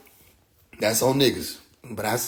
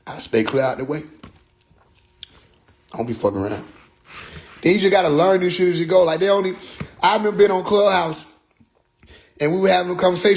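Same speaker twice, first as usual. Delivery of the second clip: normal talking voice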